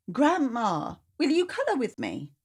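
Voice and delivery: put-on high little-girl request; small girl voice, hopeful; child asking. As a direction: ask Grandma sweetly like a child.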